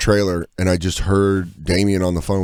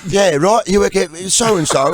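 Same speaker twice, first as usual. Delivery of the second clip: high, insistent London stranger; London accent; 8 semitones higher, slower than usual. Retold or imitated. London stranger sure they are coworkers, insistent London accent. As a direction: imitate a cocksure Londoner, pitched up. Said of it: "He was so sure".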